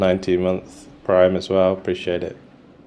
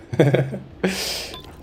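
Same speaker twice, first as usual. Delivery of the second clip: high giggle; 12 semitones higher than usual. Real, not acted. High laugh.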